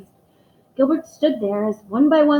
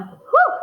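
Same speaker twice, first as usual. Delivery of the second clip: relieved whew; 6 dB louder than usual. Acted. Relief after reciting.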